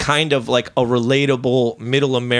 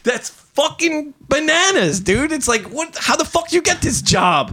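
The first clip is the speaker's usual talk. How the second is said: yelling; 11 semitones higher than usual. Real, not acted. Ranting to friends about cops, yelling. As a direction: shout it out in disbelief. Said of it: "I'm, I'm yelling".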